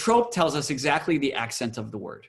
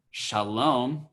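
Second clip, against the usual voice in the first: normal talking voice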